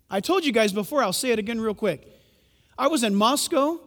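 usual speaking voice

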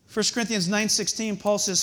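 ordinary delivery

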